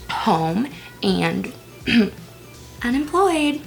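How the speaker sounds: normal talking voice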